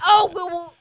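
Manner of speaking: mock voice. Mocking quote in story.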